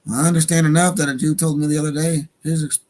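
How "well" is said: usual speaking voice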